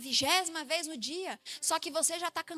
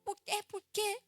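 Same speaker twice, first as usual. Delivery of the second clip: lightly voiced small child, a bit higher; little boy voice; 4 semitones higher than usual. Little son explaining to his mother, lightly voiced hurt child. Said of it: "Aí ele sentou assim perto de mim".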